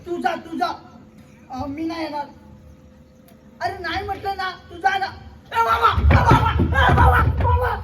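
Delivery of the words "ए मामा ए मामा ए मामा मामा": loud, high, urgent calls to uncle; calling out. Acted. Child shouting for his uncle, loud and urgent. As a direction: shout for your uncle in alarm.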